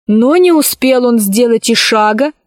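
plain reading voice

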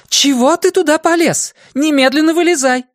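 high, loud order; commanding shout. Acted. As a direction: order the dog out now. Julia orders dog out.

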